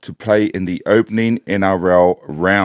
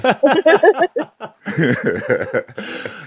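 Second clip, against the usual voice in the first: high burst of laughter. Real, not acted. High laugh after the joke.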